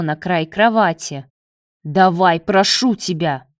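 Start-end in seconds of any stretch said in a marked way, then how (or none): none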